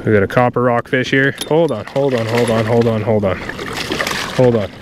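ordinary talk

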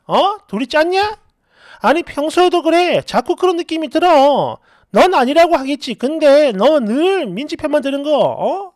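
indignant, accusing outburst with a slight lift; acted flare-up. Friend flares up at being called too sensitive, indignant. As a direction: play a friend snapping back in irritation. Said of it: "얘가 갑자기 발끈해요".

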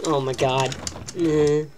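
normal talking voice

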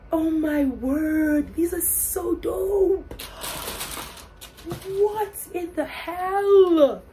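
loud, high, slow thrilled exclaims; gushing excitement. Real, not acted. Thanking her brother for shoes, thrilled loud gushing. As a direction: draw each word out in delight. Gushing over gift sneakers.

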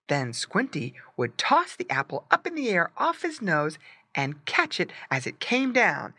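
plain reading voice

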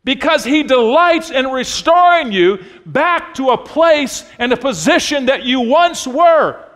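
loud, high, ringing sermon shout; full-throated preacher's cry, stretching the last words; preaching at full force. Real, not acted. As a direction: preach it out to the back row, rising to a peak. Preaching climax on restoration.

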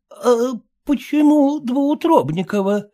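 put-on high puzzled old dame; lightly voiced baffled old woman; puzzled old lady. A narrator's reading. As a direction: ask bewildered like an old lady. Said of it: "недоумевающе взглянула".

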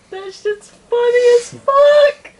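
laughing hard, high and slow. Real, not acted. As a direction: crack up while saying it.

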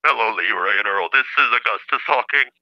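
stilted computer-synthesizer voice; Stephen Hawking. Acted. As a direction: talk like a speech machine, word by word. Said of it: "It kinda does sound like, uh, Stephen Hawking".

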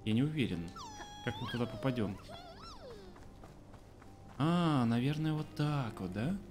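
ordinary talk

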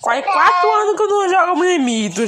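high, loud and fast outburst; an excited loud cry of nostalgia; excited exclamation. Acted. Same line, then whispered.

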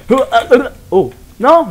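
high, loud, strained yelps; strange voice. Real, not acted. Player apologises to viewers for his strange, high voice. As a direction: squeak out the surprise. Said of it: "Excusez-moi pour la voix assez étrange".

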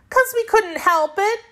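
put-on girl's voice, high and defensive; young girl Dorothy. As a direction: pitch right up like a small girl excusing herself. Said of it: "said Dorothy".

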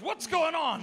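alarmed demand. Retold or imitated. Police officer finding man on the ground, alarmed. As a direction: imitate a bewildered officer demanding answers.

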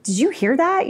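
high-pitched mock-shocked friend; imitating indignant disbelief. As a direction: act out a friend's "can you believe it". Friends react to someone's rudeness.

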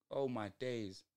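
ordinary talk, nothing marked

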